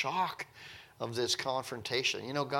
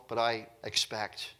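normal talking voice

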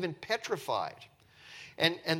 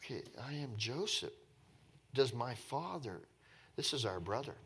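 soft, low, halting murmur; quietly piecing it together; stunned processing; 4 semitones lower, 10 dB quieter than usual. Retold or imitated. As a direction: think it through aloud in shock. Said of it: "they're processing this".

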